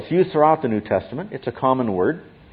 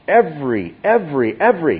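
each every higher and louder than the last; emphatic repetition; 4 semitones higher, 4 dB louder than usual. Shown as a demonstration. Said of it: "he's being emphatic".

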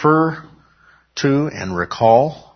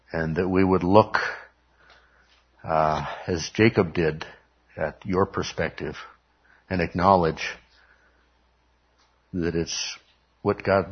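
closing prayer; 2 semitones lower than usual. Acted. Pastor leads closing prayer.

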